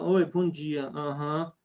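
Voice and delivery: low, dull and dying off; lifeless seller voice. Shown as a demonstration. A seller greeting a client on the phone, lifeless and dull. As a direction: sound like a salesman who has given up. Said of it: "tá morrendo".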